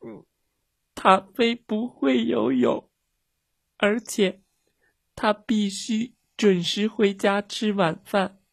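put-on high, tearful child voice; crying. A narrator's reading. Girl explaining the emergency to the pilot, crying. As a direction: explain haltingly through acted tears, pitched high. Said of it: "茉莉也哭着说".

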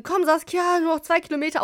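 retold spectator's rallying yell; imitated cheering shout. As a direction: egg on a tired runner, calling out. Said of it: "angeschrien, so".

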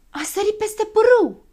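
very high, loud cry; excited yell. A narrator's reading. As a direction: yell out what you spot. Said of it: "țipă Zăpăcilă".

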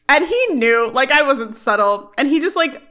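ordinary talk

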